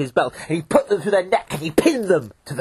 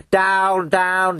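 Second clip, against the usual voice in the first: ordinary delivery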